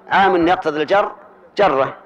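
ordinary talk, nothing marked

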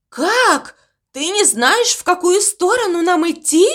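high, shocked disbelief; stunned. Acted. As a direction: ask in utter astonishment. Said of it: "ошарашенно спросила морковка". (ошарашенно, высоко)

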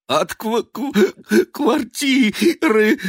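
high, slow stuttering through chattering teeth; stammer, jaw shaking with cold. A narrator's reading. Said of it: "клацая зубами".

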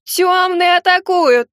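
slow, very high, loud alarm wail; wailing gargoyle. Acted. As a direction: wail it like doom has come. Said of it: "продолжала голосить статуя".

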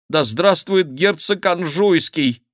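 high, slow quoted slogan; retold cheer. Favourite admits his forced cheer, high retold cry.

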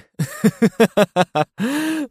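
high manic cackling laugh; maniacal cackle. Acted. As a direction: cackle like a madman. Hero laughs madly.

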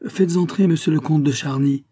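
high, loud command; firm as possible. A narrator's reading. Countess ordering the concierge to admit the count, firm. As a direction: muster all your firmness and call out. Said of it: "de la voix la plus ferme qu'elle put trouver".

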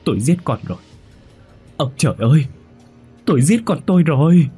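high, loud sobbing lament; crying loudly. A narrator's reading. Father weeping over the son he killed, crying loudly. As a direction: weep aloud over the son. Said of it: "Chú Ôm lấy thằng Nam khóc lớn".

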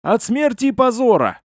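high, desperate outburst; urgent high-pitched plea; blurted out. A narrator's reading. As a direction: blurt it out mid-run. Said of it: "я выдохнул".

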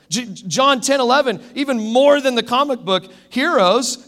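high, loud, emphatic sermon delivery; forceful preaching. Real, not acted. Preacher telling the church why we love rescuers, forceful preaching.

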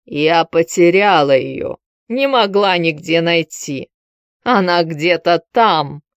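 drowsy hoarse morning-after voice; hungover rasp. Acted. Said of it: "сиплым похмельным голосом". (сиплый похмельный голос)

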